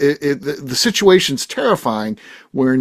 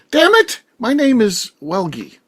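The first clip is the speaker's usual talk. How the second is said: irritated correction, pitched up, slowing on the name; too snappy; 7 semitones higher, slower than usual. Acted. Co-host imagining guest snapping at host over his name, snappy. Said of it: "too snappy in his comment".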